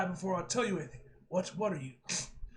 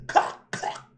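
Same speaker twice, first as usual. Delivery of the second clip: breathy whispered clucks; clucking; 7 dB louder than usual. Acted. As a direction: cluck like a hen. Rooster clucks after crow.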